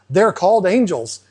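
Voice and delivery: ordinary talk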